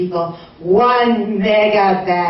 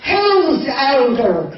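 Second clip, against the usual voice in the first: public announcement; 4 semitones higher than usual. Retold or imitated. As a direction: put it to every diner in the restaurant. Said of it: "saying to probably everyone in the restaurant hearing".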